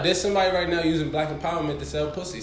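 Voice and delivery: fast, loud, high and laughing; excited. Real, not acted. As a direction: rush it out excitedly. Heated point, fast loud.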